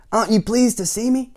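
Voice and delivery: high, loud, eager question; cheerful clumsy cousin. A narrator's reading. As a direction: ask it high and bouncy.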